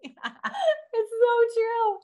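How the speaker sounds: drawn-out, high, wheezy laughing words; barely getting it out through laughter. Real, not acted. Co-host to host, laughing helplessly at the joke.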